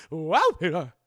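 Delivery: put-on slow, loud fussing old aunt; Aunt May voice. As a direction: put on a doting old aunt's voice. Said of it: "I gotta do the Aunt May voice".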